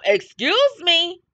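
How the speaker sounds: a protective mother stopping a bum cold; sassy mama. Pretend. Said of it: "she got a mama that's gonna say".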